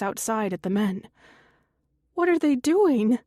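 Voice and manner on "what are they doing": higher, louder, alarmed question; frightened. A narrator's reading. Woman frightened by the men outside, frightened. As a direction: voice lifting in fear. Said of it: "she says, frightened".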